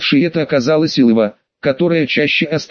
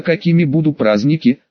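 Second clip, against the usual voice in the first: usual speaking voice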